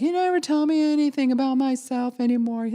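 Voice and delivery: high, complaining woman's voice; imitating the girlfriend. Girlfriend complaining to her boyfriend, high mock whine. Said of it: "she's like".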